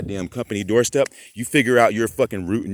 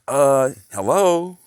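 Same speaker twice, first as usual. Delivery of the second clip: high, drawn-out mocking hello; exasperated sarcastic hello?; 6 semitones higher, 7 dB louder, much slower than usual. Real, not acted. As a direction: stretch it out like they can't be serious. Sarcastic disbelief at the radio.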